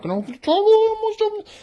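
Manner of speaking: high, loud excited squeak; excited squeal. Real, not acted. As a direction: squeal at nearly slipping up. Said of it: "catching herself nearly opening".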